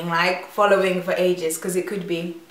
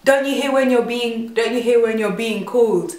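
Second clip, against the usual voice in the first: louder, higher put-on man's voice, indignant; imitating the guy; 4 semitones higher, 5 dB louder than usual. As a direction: mimic his annoyed complaint. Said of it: "he was like".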